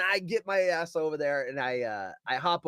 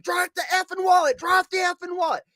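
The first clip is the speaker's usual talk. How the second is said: high, loud re-enacted shout at a thief; forceful, pitched-up imitation of yelling; retold yell; 12 semitones higher, 4 dB louder than usual. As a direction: yell the demand mid-chase. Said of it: "I start yelling at him".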